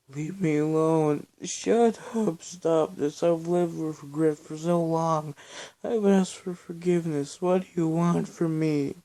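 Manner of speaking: high, loud sobbing shouts; desperate crying scream; tearful screaming. A narrator's reading. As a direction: scream it through tears and snot. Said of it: "screamed as tears and mucus ran".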